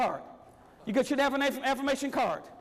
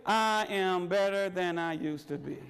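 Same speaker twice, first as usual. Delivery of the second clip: low, slow and unconvinced; weak, timid affirmation; 8 semitones lower, slower than usual. Shown as a demonstration. Speaker at a seminar modeling the wrong way to affirm, low and slow. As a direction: say it like you don't believe it. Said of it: "I don't want you to stand up and say".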